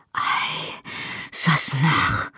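soft, slow murmur; husband murmuring to his wife, soft and slow. A narrator's reading. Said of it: "murmelte er".

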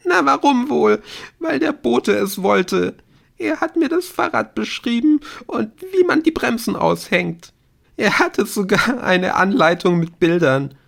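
put-on high, agitated girl's voice; overwrought, high-pitched outburst; distraught confession. A narrator's reading. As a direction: start loud and bitter, then spill it out. Distraught girl confesses under questioning.

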